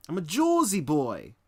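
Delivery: loud, high, hammed-up Jersey accent; mock Jersey accent. Streamer proudly telling viewers where he's from, mock Jersey accent. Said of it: "New York accent".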